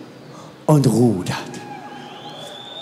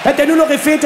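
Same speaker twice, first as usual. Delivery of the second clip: normal talking voice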